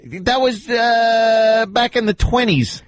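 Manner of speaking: high, slow put-on voice; exaggerated high-pitched mimicry of a caller; mocking the granddaughter. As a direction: drag it out in a high parody voice. Mock defence in the caller's voice.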